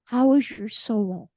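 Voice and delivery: slow and earnest, each word weighed; slow, sincere question. Retold or imitated. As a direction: ask it gently and mean it. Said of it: "She said".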